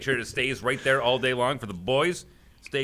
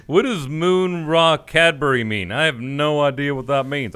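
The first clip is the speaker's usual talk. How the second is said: a light Texan twang, louder and slow; King of the Hill drawl; 3 semitones higher, 7 dB louder than usual. Pretend. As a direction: play a puzzled Texan reading a box. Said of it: "I sound like I'm from King of the Hill now".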